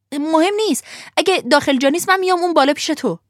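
put-on high, quick, excited boy's voice; a cheeky lad leaping at the chance; eager boy. Lampwick tells the coachman he'll sit up top, eager and high.

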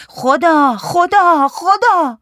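loud, high repeated cries; shouted wail. A narrator's reading. Man beating himself calls on God, shouting.

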